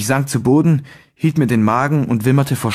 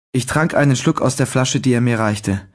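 usual speaking voice

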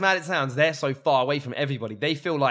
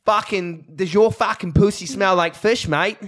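slow, broad mock Australian; Australian accent; 4 dB louder, slower than usual. Co-host asks to hear it, hammed-up Australian accent. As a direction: ask it in a cartoon Aussie twang. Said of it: "Say something so I can just hear it".

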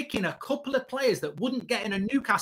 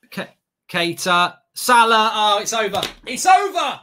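long goal yell; 2 semitones lower, 10 dB louder than usual. Real, not acted. Fan reacting live to goal.

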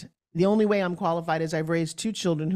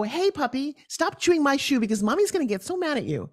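higher sing-song voice for a puppy; talking to a puppy; 6 semitones higher than usual. Retold or imitated. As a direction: coo at the dog like a pet owner. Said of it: "So if you go".

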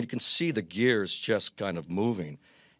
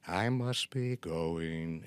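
put-on slow, soft old Groucho; old Groucho exit line; 3 semitones lower, 6 dB quieter, much slower than usual. Shown as a demonstration. Old Groucho exiting at the end of the show, slow soft farewell. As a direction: draw the farewell out slowly and quietly. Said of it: "me as old Groucho in the beret".

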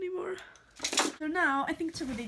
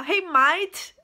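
loud, slow stab at Australian; playful, unconvincing Australian accent; attempted Australian accent; 3 semitones higher, 10 dB louder than usual. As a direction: greet loudly in a shaky Australian accent. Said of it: "I know it's not Australian".

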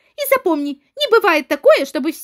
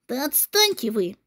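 blubbering; 8 dB quieter than usual. Acted. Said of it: "не плачь".

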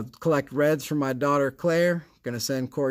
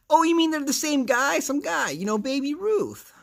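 much higher goofy clueless voice; silly high voice; 13 semitones higher than usual. Pretend. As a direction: play dumb in a squeaky voice. Mock dim character asks question.

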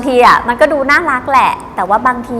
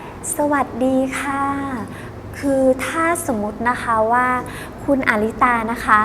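very slow, softer, stretched-out sales greeting; drawn-out slow; 6 dB quieter than usual. Shown as a demonstration. Teacher imitating a saleswoman who talks too slowly, dragging and soft. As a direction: drag every syllable like a sleepy saleswoman. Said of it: "ไม่ให้มันยืดมันยาน บางคนแบบ".

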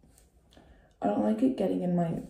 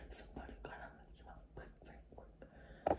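whispered lyric; 20 dB quieter than usual. Retold or imitated. Lyric repeated playfully.

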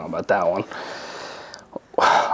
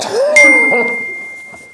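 loud laugh; 7 dB louder than usual. Real, not acted. Laughing at own joke.

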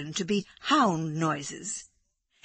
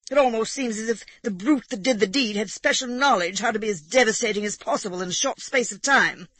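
louder, higher, indignant gentleman; aggrieved squire ranting over his hens; Egbert aggrieved; 4 semitones higher, 5 dB louder than usual. A narrator's reading. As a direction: complain loudly of the damage. Narrator voices upset Egbert.

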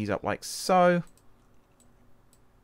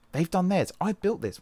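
higher, sing-song put-on voice; odd sing-song voice; 8 semitones higher, 4 dB louder than usual. Real, not acted. Musing to himself about the layout, in a higher sing-song voice. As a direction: say it in a silly lilting voice. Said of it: "Why am I saying it like that?".

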